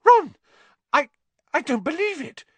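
very high-pitched shocked stammer; squealing astonishment; shriek. A narrator's reading. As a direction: shriek the name, then stammer in disbelief. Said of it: "she let out a shriek".

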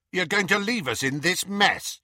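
high, challenging question; demanding. A narrator's reading. Michael Corner challenging Harry for leaving them, demanding. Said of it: "demanded Michael Corner".

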